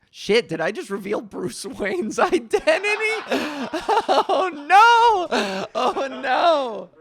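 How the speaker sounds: loud, high wail breaking into laughter; mock-panicked laughter. Real, not acted. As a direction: crack up in horror at your own slip. Blurts a secret, cracks up.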